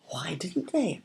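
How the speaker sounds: soft, worried little question; anxious. A narrator's reading. Arwen anxiously asks her sister, soft and worried. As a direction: ask it softly, worried. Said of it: "asked Arwen anxiously".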